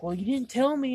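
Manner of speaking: high, loud defensive objection; mock protest, pitched well up; retold protest. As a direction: push back at an accusation, acting it out.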